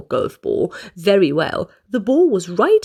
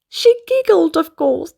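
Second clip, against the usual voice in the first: giggling; 7 semitones higher, 4 dB louder than usual. Acted. Narrator giggles while telling the child. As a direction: say it through a high giggle. Giggle acted after line.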